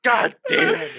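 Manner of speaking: very high, loud frustrated curse; mad outburst. Real, not acted. Player sore at losing, high loud frustrated cry. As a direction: squawk it in frustration. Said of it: "I'm so mad".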